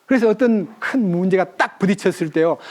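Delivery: normal talking voice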